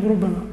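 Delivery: ربما with a single light b, rubamā; ربما said with a short, undoubled b; without the shadda. Shown as a demonstration. Teacher showing the Hijaz pronunciation of ربما. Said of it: "على لغتهم".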